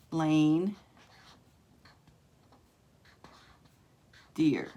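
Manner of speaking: LANE and deer split by a long pause; split word. Shown as a demonstration. Breaking the made-up word into parts.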